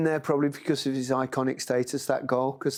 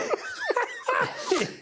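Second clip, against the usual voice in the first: squealing laugh of pure joy; high delighted laugh. Real, not acted. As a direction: laugh high and breathless with glee. Still laughing about the goal.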